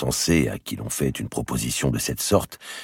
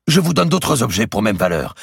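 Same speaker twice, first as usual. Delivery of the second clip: higher, louder urgent prelate; pleading archbishop; 9 semitones higher, 7 dB louder than usual. A narrator's reading. The archbishop bargains with the merchant, high and urgent. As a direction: press the offer hard, higher.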